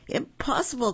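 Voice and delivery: high-pitched outburst of disbelief; storybook exclamation, pitched well up; crying out. A narrator's reading. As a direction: cry it out high, as Papa Wolf. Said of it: "cried Papa Wolf".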